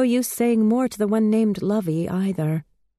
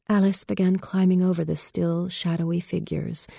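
usual speaking voice

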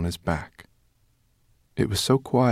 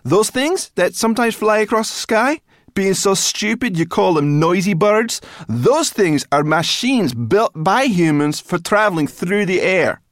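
very high, loud tirade; furious brownie lecturing at full pitch; angry, nearly breathless rant; 14 semitones higher, 12 dB louder than usual. A narrator's reading. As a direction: rant on as if running out of air. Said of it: "Sora was practically gasping for air".

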